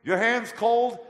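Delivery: high, loud friendly call from a car; friendly driver. Retold or imitated. Driver calling from his car window, high loud. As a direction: call out to the guy on the corner. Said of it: "and said".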